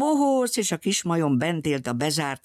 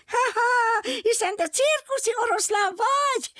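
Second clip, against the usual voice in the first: very high squeaky monkey voice with a laugh; squealing monkey. Acted. Little monkey laughs at the circus lion, very high squeal. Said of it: "visította a majmocska".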